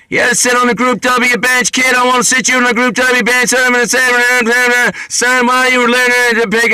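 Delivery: high, loud hammed-up official barking at a kid; mock officer voice. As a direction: play the gruff cop from the song. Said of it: "[name] had a thing with Alice's Restaurant".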